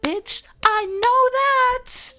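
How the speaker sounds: high, loud, slow drawn-out comeback; sassy retort. Retold or imitated. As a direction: snap back with attitude. Retorting to the cop.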